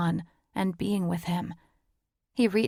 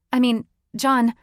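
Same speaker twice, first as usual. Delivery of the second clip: panicked; 5 semitones higher, 7 dB louder than usual. A narrator's reading. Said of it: "I freaked".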